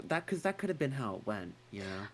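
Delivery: ordinary talk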